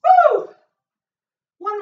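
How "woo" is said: long, very high, loud woo; triumphant whoop. Real, not acted. Trainer finishing the last rep, triumphant whoop.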